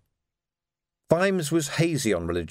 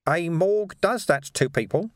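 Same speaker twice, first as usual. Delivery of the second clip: matter-of-fact; 7 semitones higher than usual. A narrator's reading. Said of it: "in a matter-of-fact voice".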